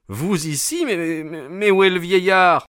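startled questioning that rises to a cry; astonished. Acted. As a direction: blurt it out, bewildered. Astonished at Holmes's sudden appearance.

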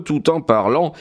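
usual speaking voice

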